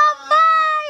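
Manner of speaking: high, loud joyful shout; excited call. Real, not acted. Mother calling out as dad comes home, high and loud. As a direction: call out to daddy with delight. Daughter sees dad arrive.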